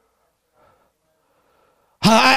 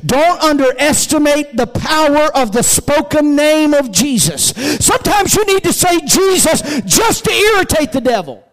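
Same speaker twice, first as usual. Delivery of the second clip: raised, ringing exhortation; full-force preaching; 6 semitones higher than usual. Real, not acted. Preacher urges saying the name of Jesus, full-force preaching. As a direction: climb to a high, punched climax.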